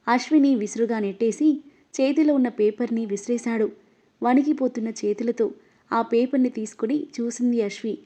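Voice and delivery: plain reading voice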